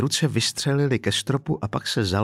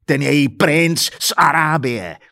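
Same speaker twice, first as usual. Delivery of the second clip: slow, louder scornful roar; angry shout, drawn out and raised; bellowed; 4 semitones higher, 5 dB louder, slower than usual. Acted. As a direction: punch out each word in sarcastic fury. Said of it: "poslední slova zařval".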